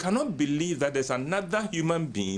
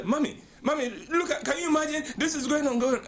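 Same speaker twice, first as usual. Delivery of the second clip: high, complaining daughter's voice; whiny daughter; 11 semitones higher, faster than usual. Retold or imitated. Young wife calling her mother to complain, high and whiny. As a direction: wail to mommy like a hurt bride. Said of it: "she will call her mother and say".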